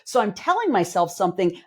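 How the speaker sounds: ordinary talk, nothing marked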